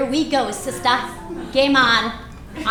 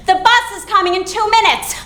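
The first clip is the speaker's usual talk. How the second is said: raised, higher warning call; yelling from downstairs; 6 semitones higher, 4 dB louder than usual. Acted. Husband warning that the bus is coming, yelling. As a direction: holler a deadline up to them. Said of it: "my husband's yelling from downstairs".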